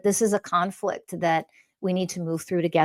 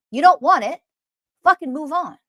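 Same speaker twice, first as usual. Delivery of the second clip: angry dismissal; 6 semitones higher, 6 dB louder than usual. Real, not acted. Dismissing listeners.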